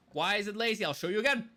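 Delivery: ordinary talk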